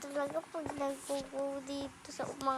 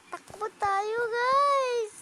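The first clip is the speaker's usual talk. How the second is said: higher, louder call to run; while running; 5 semitones higher, 8 dB louder, slower than usual. Pretend. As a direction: shout it while running away. Fleeing the teacher in game.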